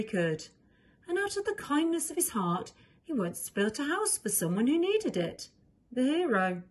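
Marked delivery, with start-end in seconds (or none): none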